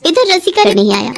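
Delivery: ordinary delivery, nothing marked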